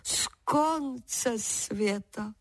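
drawn-out amused disbelief; laughing scorn. A narrator's reading. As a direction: repeat it slowly in mild derision. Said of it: "zasmál se ovčák".

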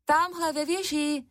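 high-pitched storybook call. A narrator's reading. Sister answers the witch from below, calling out high. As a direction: call it out high, without much extra force. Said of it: "zvolala Černucha".

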